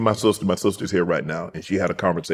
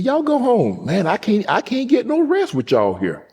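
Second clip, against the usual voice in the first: fussing grandmother; 13 semitones higher than usual. Retold or imitated. Grandmother shooing family home, high fussy voice. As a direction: pitch way up, play a fed-up old granny.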